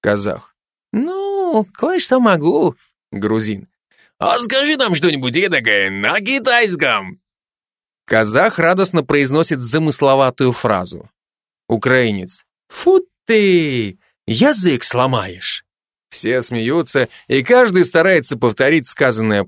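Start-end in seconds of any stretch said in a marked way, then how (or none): 0.9-2.8 put-on much higher, slow Kazakh-accented reply. Kazakh soldier answering the driver, in a Kazakh accent. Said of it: "каждый со своим акцентом". 4.2-7.2 put-on higher Georgian-accented request. Georgian soldier urging the Kazakh, in a Georgian accent. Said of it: "каждый со своим акцентом". 12.7-15.7 put-on Ukrainian accent. Ukrainian soldier reacting to the Chinese phrase, in a Ukrainian accent. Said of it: "каждый со своим акцентом".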